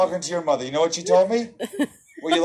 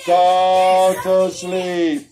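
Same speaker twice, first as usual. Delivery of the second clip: very slow, drawn out and louder; slow spell command; 5 dB louder, much slower than usual. Shown as a demonstration. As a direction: command the balloon slowly, like a hypnotist. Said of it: "and you say".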